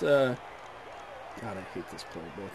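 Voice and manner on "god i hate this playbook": fast, much softer grumble; mumbling under breath. Pretend. Coach grumbling privately about his playbook, mumbled fast. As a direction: grumble it to yourself, barely audible. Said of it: "Coach Super Paul mumbling under his breath".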